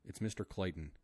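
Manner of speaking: ordinary delivery, nothing marked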